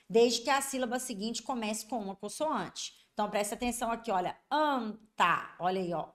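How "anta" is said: ANTA drawn out in two parts, first A nasal; nasal vowel. Shown as a demonstration. Teacher shows the vocalic digraph, slow nasal vowel. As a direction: stretch "anta" as ãn-ta, slowly. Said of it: "é como se fosse um A".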